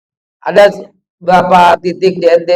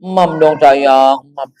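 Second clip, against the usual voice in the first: put-on slow, lower, cooing baby talk; coaxing baby talk; 6 semitones lower, slower than usual. Parent persuading a two-year-old to eat, coaxing baby talk. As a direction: coax a toddler with drawn-out babyish words. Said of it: "Dirayu kan? ... Suara kita langsung emam".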